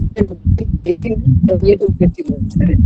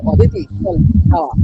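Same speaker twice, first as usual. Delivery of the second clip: blunt demand; 9 semitones higher than usual. Retold or imitated. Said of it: "সোজাসুজি বলতে পারে যে".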